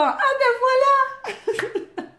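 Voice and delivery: high, drawn-out laughing squeal; playful, giggly high-pitched exclamation; amused exclamation. Acted. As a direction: stretch it out high through laughter. Laughs after comment on her accent.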